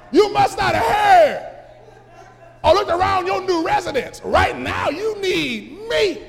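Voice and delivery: loud, high, mocking preaching; preaching at full force. Lazarus taunts the rich man, loud high preaching. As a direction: let him hear who needs whom.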